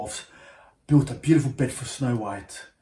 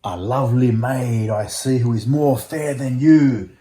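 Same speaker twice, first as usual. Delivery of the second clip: louder, booming mirror pronouncement; the mirror tells the queen, grandly; magic mirror voice; 2 semitones higher, 10 dB louder than usual. Acted. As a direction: announce it like an enchanted mirror.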